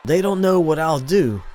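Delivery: high, loud angry snap; snapped. A narrator's reading. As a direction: bite the line off in defiance. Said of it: "the unknown voice snapped".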